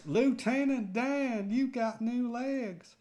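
high, slow, loud Southern drawl; hammed-up movie quote in a Southern American accent; Forrest Gump. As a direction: call it out high and drawn out, imitating a Southern simpleton. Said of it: "Forrest Gump was one of those".

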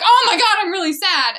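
high, loud burst of mock distress; mock sad outburst. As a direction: imitate a sudden melodramatic meltdown. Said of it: "she's not crying".

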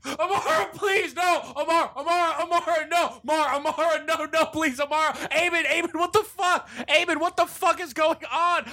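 high-pitched hysterical pleading; frantic grief. Acted. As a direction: wail and beg the dead friend to come back. Character frantic over friend's death.

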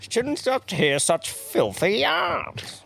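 high, slow, prissy old-man imitation; mocking the old bloke. Host mocking the old bloke from the clip, high and slow. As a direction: drag out filthy yarns like the old bloke. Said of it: "the use of the word yarn and filthy yarns".

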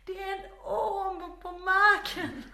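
slow, high, loud re-enacted cry; desperate shouting. Daughter repeats snake warning to baffled dad, desperate slow shout. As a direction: drag it out loudly so he finally understands.

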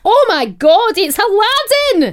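high, loud mock Scottish exclamation; Scottish accent. Co-host shows how the quote should go, loud Scottish accent. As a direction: belt it out in a hammed-up Scottish accent. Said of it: "That's it, but in a two-year-old's accent".

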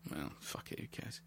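hushed, dismissive aside; very soft, under the breath; whispered aside. Real, not acted. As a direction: drop right down to a whisper. Dismissing spoiler worry as an aside.

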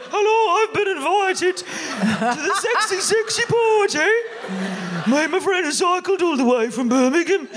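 high, loud mock boy's voice, laughing; teenage boy. A pretend boy turning up to the party, high and laughing. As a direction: play a keen young lad arriving. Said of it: "Be like".

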